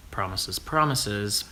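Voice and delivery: ordinary delivery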